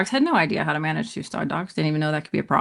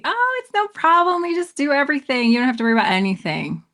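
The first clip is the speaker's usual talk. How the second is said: much higher, breezy fake reassurance; mock salesman pitch; 8 semitones higher than usual. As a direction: oversell it like a smooth talker. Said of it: "giant pitch of false confidence".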